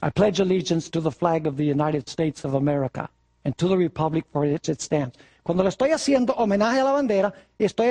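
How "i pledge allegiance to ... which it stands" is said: hushed solemn pledge in English; reciting the pledge. Pretend. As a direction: recite it like a schoolroom oath. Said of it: "y yo digo".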